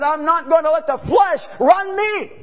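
loud, high, defiant declaration; defiant vow. Retold or imitated. Preacher voicing Joshua's vow to the church, defiant and loud. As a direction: throw it out as a battle cry.

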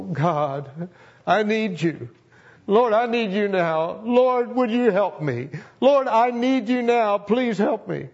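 high, pleading cry to God, voice breaking; pleading prayer. Retold or imitated. Preacher reliving his roadside prayer, high and pleading. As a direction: beg God for help through tears. Retold tearful highway prayer.

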